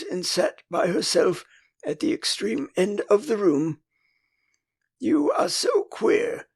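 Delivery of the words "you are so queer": much higher and slow, openly baffled; frankly puzzled. A narrator's reading. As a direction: stretch it out, truly puzzled. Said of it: "frankly puzzled".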